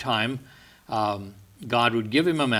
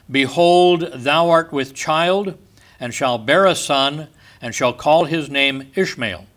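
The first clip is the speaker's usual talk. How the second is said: raised, solemn, declaiming reading of scripture; 5 dB louder than usual. Acted. Preacher reading Genesis to his congregation, solemn declaiming. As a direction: proclaim the verses to the congregation. Reads Genesis aloud in sermon.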